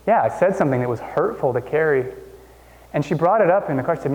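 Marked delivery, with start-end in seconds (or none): none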